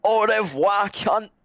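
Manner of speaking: hammy fake French accent; bad French accent. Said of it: "You sound like Delbo trying to speak French".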